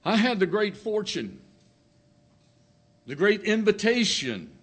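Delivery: high, loud preacher's call; ringing sermon delivery, pitched high; preaching. Acted. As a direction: lift the congregation, full preaching voice.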